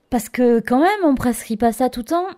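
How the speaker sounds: high, loud, doubtful nurse; insisting nurse. Retold or imitated. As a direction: press her, are you really sure. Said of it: "insiste-t-elle".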